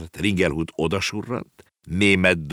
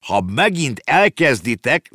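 louder, higher, slow warning; snapping; 6 semitones higher, 5 dB louder than usual. A narrator's reading. Ringelhut snapping at the parents, slow loud warning. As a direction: bite it off as a threat. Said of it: "csattant fel Ringelhut".

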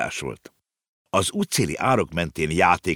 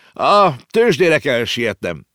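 slow, louder self-important call; hurried boy; 8 semitones higher, 7 dB louder, slower than usual. A narrator's reading. The boy calls back from his car: self-important call. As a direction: grandly announce your errand.

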